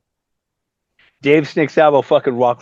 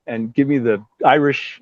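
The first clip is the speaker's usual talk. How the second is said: ordinary talk, nothing marked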